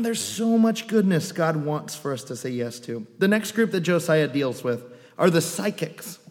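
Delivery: usual speaking voice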